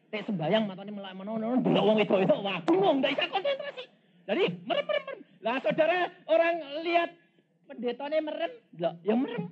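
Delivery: high, loud and laughing sermon; preaching at full force with laughter; fiery preaching. Acted. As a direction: tease the crowd about the pastors. Preacher's mocking tale, laughing.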